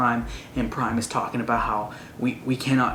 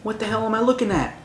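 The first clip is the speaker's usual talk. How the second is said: shocked mock exclamation; 10 semitones higher, faster than usual. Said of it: "He's like".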